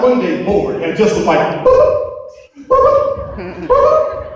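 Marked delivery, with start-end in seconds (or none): 1.6-4.2 high silly boop noises; key fob beeps. Retold or imitated. Mimicking a bored friend clicking the alarm, high boops. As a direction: chirp like a car alarm fob.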